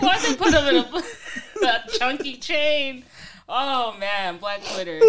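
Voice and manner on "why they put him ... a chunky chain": slow words broken by laughter; laughing. Real, not acted. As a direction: laugh through the question. Cracking up at meme.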